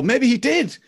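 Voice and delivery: high-pitched speculation; mock wondering. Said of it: "you're left wondering".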